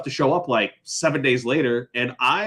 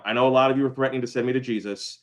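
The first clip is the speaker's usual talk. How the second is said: ordinary delivery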